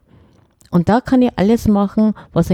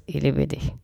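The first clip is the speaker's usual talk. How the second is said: „Ich liebe dich“ kurz, leise und tief hingeworfen; 5 semitones lower, 9 dB quieter than usual. Shown as a demonstration. (kurz und leise: „Ich liebe dich“)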